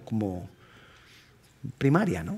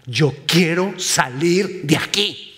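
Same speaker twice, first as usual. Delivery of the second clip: slow, deliberate, building in pitch; drawn-out preaching resolve; emphatic declaration; 4 semitones higher, slower than usual. Acted. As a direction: declare it word by word, rising. Preacher voices resolute plea, building.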